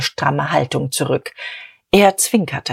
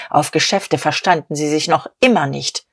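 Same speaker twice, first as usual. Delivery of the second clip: ordinary delivery, nothing marked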